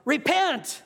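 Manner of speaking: high sudden cry of repent across the river; yelled. Acted. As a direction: fling it across the water like a wild prophet. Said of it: "And he yells".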